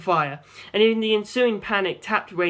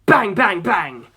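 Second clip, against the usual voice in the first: bang bang; 5 dB louder, slower than usual. A narrator's reading. Gunshot sounds imitated.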